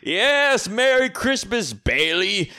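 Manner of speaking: high, slow, drawn-out reply; hammed-up holiday greeting; mock movie greeting. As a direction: stretch it out theatrically. Acting out Potter's exchange with George.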